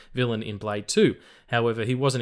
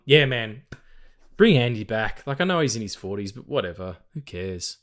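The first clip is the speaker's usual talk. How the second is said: usual speaking voice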